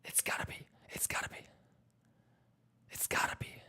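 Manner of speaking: breathy whispered build-up. Acted. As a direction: whisper it with suspense.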